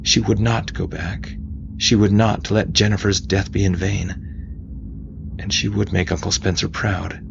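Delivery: ordinary delivery, nothing marked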